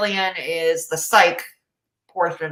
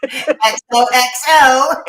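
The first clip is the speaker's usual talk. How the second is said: slow, louder teasing sign-off; Gossip Girl tone; 4 semitones higher, 6 dB louder, slower than usual. Shown as a demonstration. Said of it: "my perfect Gossip Girl tone".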